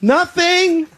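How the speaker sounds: high, startled "nothing"; girlish denial. Retold or imitated. Man caught looking at his boobs in the mirror, high squeak. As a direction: squeak it like a caught teenage girl. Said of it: "when you're going".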